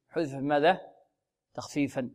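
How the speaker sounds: ordinary delivery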